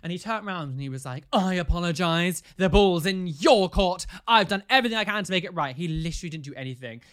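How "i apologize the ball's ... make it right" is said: indignant mock apology. Recounting a friend's non-apology to the co-host, indignant and loud.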